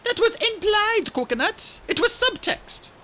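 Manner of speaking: put-on high, bright young dragonette voice; chirpy excited young dragon; eager little RainWing voice; Kinkajou's voice. Kinkajou explaining smugly.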